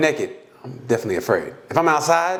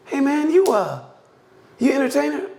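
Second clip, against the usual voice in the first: higher, eager stranger at the gym; imitating stranger; 11 semitones higher than usual. Retelling a fan at the gym, mock eager. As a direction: ask like an excited fan. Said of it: "He goes".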